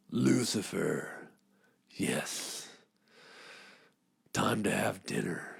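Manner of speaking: low, hushed ominous dad; low hushed dad at dinner; sinister parent. Pretend. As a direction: drop into a creepy secret voice. Parent voice behind closed door.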